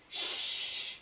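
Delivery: unvoiced sharp shush. A narrator's reading. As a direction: hush them fast. Punchinello hushing friends.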